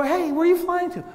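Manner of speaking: high, loud acted question; hammed-up curious greeting; exaggerated friendly hail. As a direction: call out to a fellow traveler. Acting out hailing a bizarre traveler.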